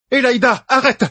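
high, urgent shout to stop; alarmed cry. A narrator's reading. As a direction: cry out to halt an attack. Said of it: "s'écria Rubinde". (cri aigu et pressant)

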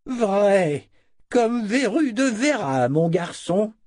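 put-on very high, drawn-out old man's voice; old wizard. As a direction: confirm it loudly as a quirky elder. Old wizard confirms who he is. (vieux sorcier à la voix aiguë)

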